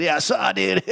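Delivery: high-pitched SoCal dude; surfer-dude Southern California drawl; Southern California surfer accent. Retold or imitated. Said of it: "he speaks with a Southern California dialect".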